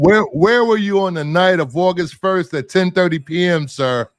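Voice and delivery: mock interrogating cop voice; tough cop. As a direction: grill him like a detective. Mock interrogation after lighting joke.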